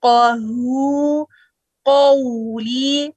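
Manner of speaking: drawn-out chanted क़हू, क़ौली; slow recitation. Shown as a demonstration. Teacher reciting for students. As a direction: recite slowly.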